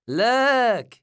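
high, loud, stretched-out call; excited announcement. A narrator's reading. Rosamond shows off her two boxes, excited call. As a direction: show it off proudly.

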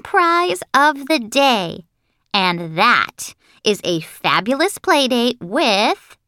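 put-on high, slow, self-important little girl; high, drawn-out boast, peaking on her own name; show-off Lucille. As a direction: brag grandly, stretching the big words.

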